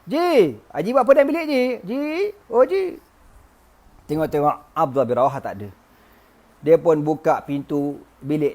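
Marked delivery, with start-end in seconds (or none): 0.0-3.0 high calling voice, name by name. Acted. Wife calling her husband's friend in the room, calling out. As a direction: call for someone in another room. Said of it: "Dia panggil".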